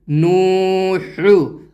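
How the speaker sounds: very slow "نو ہو" with the first vowel held long; teacher blends "نو ہو" slowly for pupils; joined word, drawn out. Shown as a demonstration. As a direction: stretch the و of "نو" before joining "ہو". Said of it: "کون سا حرف کھنچے گا".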